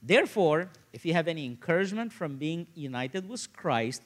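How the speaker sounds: ordinary talk, nothing marked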